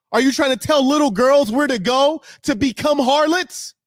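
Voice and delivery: high, loud, rising string of angry questions; furious shout. Real, not acted. Streamer berating commenters for correcting him, furious shout. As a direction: lash out, building with each question.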